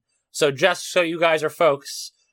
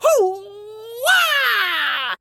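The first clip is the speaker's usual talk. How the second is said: battle cry. Pretend. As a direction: let out a big macho whoop. Host yells a movie catchphrase.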